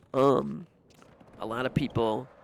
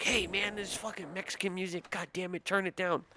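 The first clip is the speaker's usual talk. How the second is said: higher-pitched mock grumbling; irritated complainer; 7 semitones higher than usual. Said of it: "Italians be racist and they're like".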